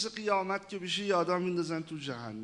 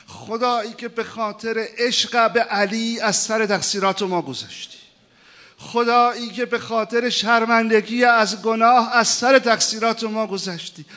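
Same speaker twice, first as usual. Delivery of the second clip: rising, louder pleading prayer; preaching at full force; 6 semitones higher, 9 dB louder than usual. Acted. Preacher calling out to God, impassioned. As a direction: cry out to God in mounting fervor.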